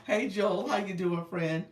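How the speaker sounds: ordinary talk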